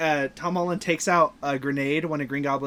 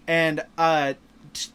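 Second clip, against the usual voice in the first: ordinary delivery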